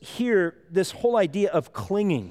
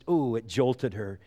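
ordinary talk

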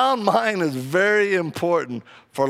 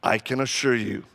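lower, softer, dry reply from the stall; a hint of a stern stranger, deadpan; stranger's voice; 7 semitones lower, 4 dB quieter than usual. Man in the stall answering the lady, low and deadpan. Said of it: "you hear this voice".

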